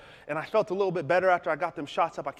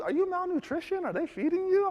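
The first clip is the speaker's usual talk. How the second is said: mocking bully. Bullies mocking his thinness.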